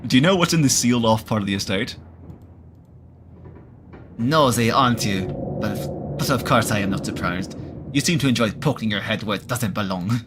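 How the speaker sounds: a hammed-up, failing French accent for the cat; a shaky stab at a French accent; bad French accent. Frou Frou the cat answering the player, bad French accent. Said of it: "I cannot do a French, French accent".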